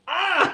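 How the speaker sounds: high-pitched mock scream of fright; imitated grandma's shriek; sudden high yelp; startled yelp. Grandma startled by blasting radio.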